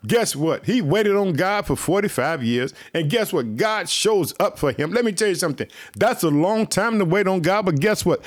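ordinary talk, nothing marked

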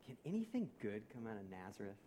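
low, soft, weighty question; quiet quoted doubt. Retold or imitated. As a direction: let the skeptical question sink, hushed and low. Quotes Nathanael, first take weighty.

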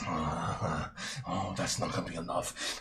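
low gloating killer; deep menacing villain. Acted. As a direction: drop the voice deep and mock them. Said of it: "and he goes".

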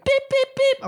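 three very high, short beeps; imitated alarm chirp. Imitating the mystery chirping at home, very high beeps. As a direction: squeak out three identical electronic beeps. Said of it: "something's just chirping".

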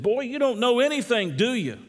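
high-pitched mock exasperation; exasperated. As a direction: play the lost man losing patience with the boy. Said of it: "sort of exasperated".

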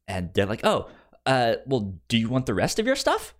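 ordinary talk

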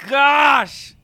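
slow drawn-out pained yell; pained shout. Real, not acted. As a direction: cry it out in burning pain. Shouts in pain from the chip.

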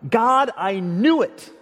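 slow, loud yell, pitched high; yelled at God. Retold or imitated. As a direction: hurl it at God in fury. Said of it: "He yelled at God".

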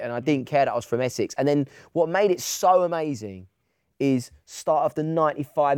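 ordinary talk, nothing marked

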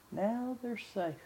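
soft, slow, hushed murmur; retold whisper. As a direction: breathe it gently like a secret. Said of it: "he whispered in my ears".

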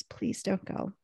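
very soft, barely voiced plea; retold sobbing plea. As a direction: plead faintly, imitating tearful speech. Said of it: "she's just sobbing and says".

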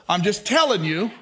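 ordinary talk, nothing marked

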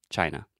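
China as CHY-nuh, low and relaxed; schwa ending. Shown as a demonstration. Teacher models the standard word, China with schwa ending. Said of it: "and then the schwa".

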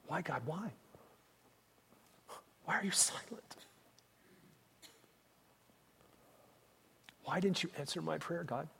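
soft, whispered questioning of God; hushed plea. Retold or imitated. Grieving parents questioning God, whispered plea. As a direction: ask God in a near whisper.

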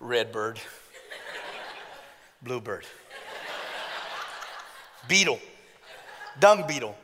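hammed-up low dopey Adam running out of names; tired dopey Adam. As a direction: list the creatures low and weary, like a dopey caveman. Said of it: "he's like".

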